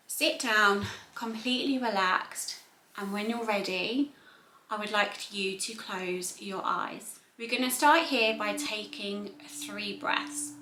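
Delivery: soft, unhurried voice with long pauses; soothing body-scan narration, hushed and spacious; guided meditation. Acted. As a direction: gently guide them into calm, phrase by phrase. Leads a guided relaxation and body scan.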